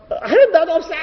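high mock protest; wanting to storm out, shouting at God in mock protest; indignant outcry. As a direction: huff it out like storming off. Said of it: "막 이러면서 나가면서".

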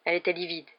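ordinary delivery

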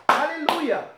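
high bright halleluja to lift the room; excited halleluja. Shown as a demonstration. Preacher showing leaders how to praise, high and excited. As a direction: sing out halleluja, pitched high. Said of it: "als we zeggen, we gaan nu God aanbidden".

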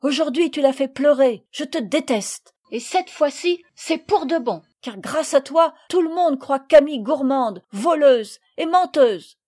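furious accusation. A narrator's reading. Marguerite accuses Sophie of hurting Camille, furious accusation. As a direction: hurl each reproach angrily. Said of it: "d'un œil enflammé de colère".

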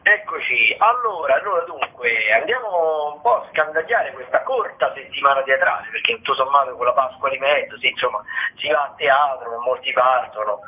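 ordinary delivery

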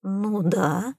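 hesitant, drawn-up agreement; unsure. A narrator's reading. Tom half-agrees with Ray's tall story, unsure. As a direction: agree doubtfully, as if not convinced. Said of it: "неуверенно произнёс Том".

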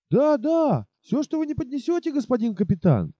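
put-on high, fawning drunk soldier; tipsy soldier eager to please. Drunk soldier answering captain. (пьяный подобострастный солдат)

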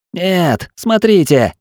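high urgent cry; shouting. A narrator's reading. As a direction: shout a warning, pointing.

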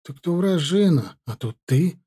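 ordinary delivery, nothing marked

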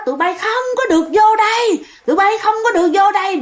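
high-pitched, retold tirade; angry scolding. Old woman locked in her room to her children, angry scolding. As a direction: act out an old woman warding people off. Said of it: "Bả chửi quá trời".

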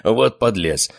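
chuckle, then jovial remark; good-humoured chuckle leading into praise; amused laughing. A narrator's reading. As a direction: laugh it off as a hearty uncle. Uncle laughs at the retort.

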